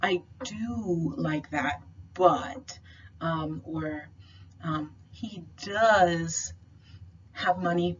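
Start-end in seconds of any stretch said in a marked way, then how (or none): 0.0-2.8 slow, drawn-out stress on "do"; emphatic do. Shown as a demonstration. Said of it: "sort of mirrors the emphatic do or does". 5.1-8.0 heavy stress on "does"; emphatic does. Shown as a demonstration. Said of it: "sort of mirrors the emphatic do or does".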